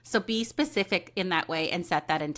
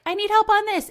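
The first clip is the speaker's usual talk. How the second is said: much higher and louder plea; pleading; 13 semitones higher, 6 dB louder, faster than usual. Pretend. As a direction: pitch it up high and louder, begging. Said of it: "So if you're like".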